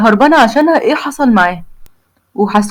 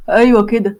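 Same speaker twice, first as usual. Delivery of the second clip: ordinary delivery, nothing marked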